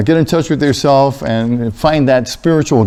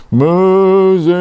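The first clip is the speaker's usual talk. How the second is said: higher, long drawn-out call; voice from the bush; 4 semitones higher than usual. Acted. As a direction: stretch the name out, pitched up, like a divine summons. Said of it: "I heard this voice from inside the bush".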